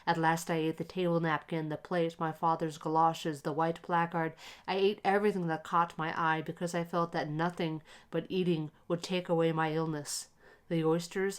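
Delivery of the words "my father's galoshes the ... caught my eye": ordinary delivery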